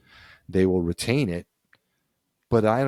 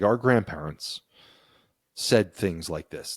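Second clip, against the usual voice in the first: ordinary talk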